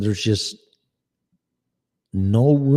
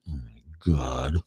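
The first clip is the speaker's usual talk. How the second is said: ordinary delivery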